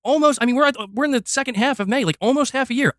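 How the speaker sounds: usual speaking voice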